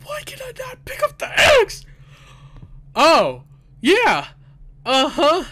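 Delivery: high, loud panicked voice; frightened. Real, not acted. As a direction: squeak it out in fright. Startled by horror game scare.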